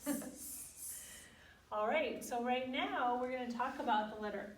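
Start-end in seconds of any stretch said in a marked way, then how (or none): 0.1-0.6 soft unvoiced snake hiss. Shown as a demonstration. Teacher ending song with a quiet snake hiss. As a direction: hiss it gently. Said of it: "we do know that they go".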